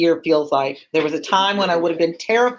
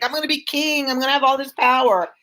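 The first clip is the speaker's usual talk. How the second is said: higher, eager voice imitating Macbeth's early excitement; hammed-up gleeful ambition, pitched up; mock enthusiastic; 8 semitones higher than usual. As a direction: play it like a man who can't wait to be king. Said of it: "He was all enthusiastic".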